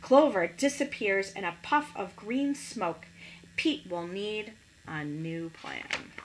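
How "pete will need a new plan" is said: usual speaking voice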